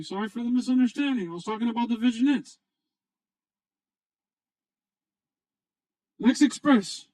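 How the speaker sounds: squeaky high joke voice. Pretend. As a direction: play the late guest in a squeaky high pitch. Joke voice of latecomer guest.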